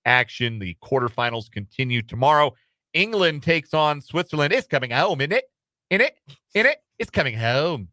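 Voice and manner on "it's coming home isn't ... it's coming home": hammed-up British football fan accent. Host mimicking English fans over the quarterfinal, bad British accent. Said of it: "British accent I tried to affect".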